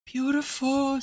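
high, dreamy and drawn out; gushing. Real, not acted. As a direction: swoon over the word beautiful. Gushing over the song.

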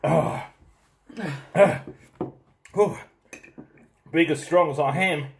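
gasping through a burning mouth; strained, mouth on fire from chili; mouth burning from spicy food. Real, not acted. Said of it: "Hot as".

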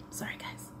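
breathy unvoiced sorry guys; a real whispered aside; whispered apology. Real, not acted. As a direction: murmur it off-mic to the viewers. Whispered aside after clearing throat.

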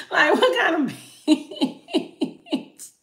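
high, slow words broken by laughter; giggling which-beans; laughing disbelief. Pretend. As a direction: laugh through the question in disbelief. Laughing at the beans question again.

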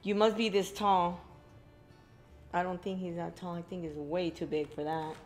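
normal talking voice

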